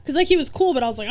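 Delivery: ordinary talk, nothing marked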